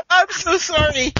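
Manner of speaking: high, loud, drawn-out laughing apology; slow words squeezed through laughter; helpless laughter. Real, not acted. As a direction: apologise while cracking up. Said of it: "I'm laughing here".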